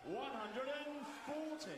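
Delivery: stretched-out shouted "forty"; darts score caller. Real, not acted. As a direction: belt out the big score. Caller shouts the maximum-type score.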